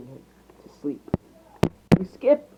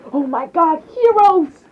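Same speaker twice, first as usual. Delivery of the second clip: high squeal building louder; fanboy shout at seeing idols; thrilled, pitched-up exclamation; starstruck excitement; 13 semitones higher, 7 dB louder, slower than usual. A narrator's reading. Starstruck boy spots heroes.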